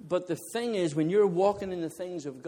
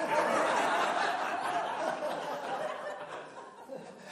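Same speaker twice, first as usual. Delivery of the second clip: high breathless laugh; 10 semitones higher, 3 dB quieter than usual. Real, not acted. Laughing at being asked to bowl again.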